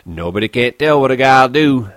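ordinary delivery

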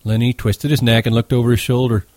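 plain reading voice

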